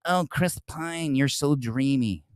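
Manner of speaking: soft, slow, breathy-free mock swoon; mocking the critic fawning over Chris Pine; swooning fan. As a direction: drop low and soft like a smitten fan. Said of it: "Katie's doing an interview".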